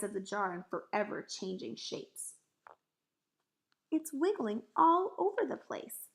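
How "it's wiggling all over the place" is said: high, louder exclamation; alarmed cry. A narrator's reading. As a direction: cry out what you see, agitated. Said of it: "Sophie cried".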